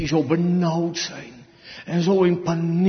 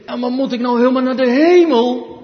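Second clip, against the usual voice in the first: a lightly voiced little boy, louder and puzzled; young boy's worry; 5 semitones higher, 7 dB louder, faster than usual. The preacher recalls his boyhood doubt, lightly voiced boy. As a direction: play yourself as a fretting boy. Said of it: "vroeger dacht ik als jongetje".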